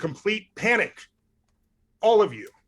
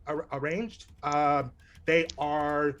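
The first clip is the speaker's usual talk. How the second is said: usual speaking voice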